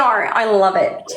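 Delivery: normal talking voice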